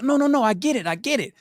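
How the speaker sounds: high, fast eager pleading; pleading eagerly. Retold or imitated. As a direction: talk fast to save the job. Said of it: "I'm like".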